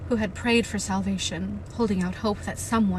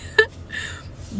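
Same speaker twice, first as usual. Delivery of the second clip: breathy disbelieving laugh; 14 semitones higher than usual. Real, not acted.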